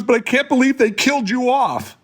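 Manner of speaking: high, teary mock sob of a crying sister. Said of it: "and she's crying".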